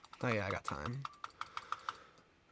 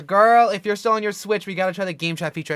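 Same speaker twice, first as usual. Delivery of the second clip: excited; 5 semitones higher, 12 dB louder, faster than usual. Real, not acted. Excited about new game chat.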